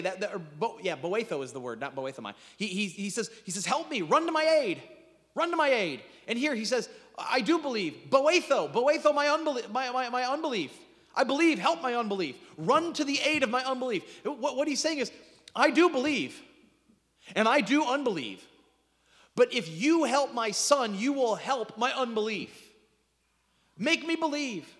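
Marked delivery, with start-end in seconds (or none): 3.6-6.0 much higher and louder, begging; high loud begging cry; desperate plea. Acted. Father begging acted out. 7.2-14.0 higher and louder, pleading; loud high impassioned plea; pleading cry. Acted. Father's confession acted out. 15.6-24.7 higher and louder, pleading; loud high earnest plea; impassioned plea. Acted. Paraphrase voiced as the father.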